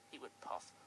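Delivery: usual speaking voice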